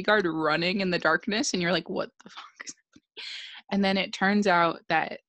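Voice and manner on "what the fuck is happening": fast, hushed whisper; whispered reaction. Retold or imitated. Viewer's reaction to the dark scene.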